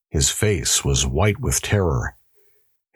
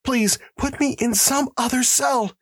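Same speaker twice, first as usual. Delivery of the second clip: much higher, panicked gasp; gasped in fear. Acted. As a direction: gasp it out desperately. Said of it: "gasped the prisoner".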